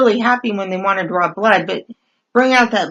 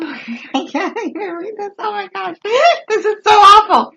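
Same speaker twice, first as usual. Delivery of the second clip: laughing; 9 semitones higher than usual. Real, not acted. Laughing while reading lewd message.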